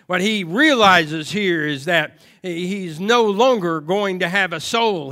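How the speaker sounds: usual speaking voice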